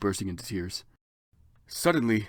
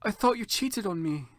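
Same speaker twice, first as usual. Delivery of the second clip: put-on girly apology; 10 semitones higher than usual. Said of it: "I expelled".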